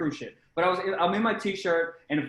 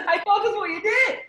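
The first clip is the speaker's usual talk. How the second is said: much higher, laughing through every word; real laughter, higher and louder; laughing hard; 5 dB louder than usual. Real, not acted. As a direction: crack up while repeating it. Repeats it laughing harder.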